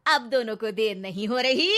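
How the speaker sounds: loud, pointed interruption; cutting in. Acted. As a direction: butt in sharply. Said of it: "टोकते हुए बीच में बोल पड़ती है". (ज़ोर से टोकते हुए)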